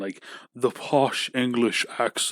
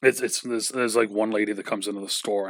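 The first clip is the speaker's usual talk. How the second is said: ordinary talk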